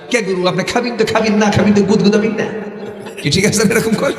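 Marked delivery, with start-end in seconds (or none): 0.1-2.4 Dupchanchia dialect. Retold or imitated. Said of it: "বগুড়ার পশ্চিম".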